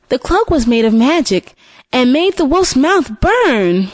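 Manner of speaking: ordinary delivery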